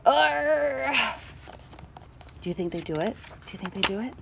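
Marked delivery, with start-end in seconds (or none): 0.1-1.1 long effortful "urgh"; straining grunt. Acted. Tools pull the chain, straining. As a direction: heave with a drawn-out grunt.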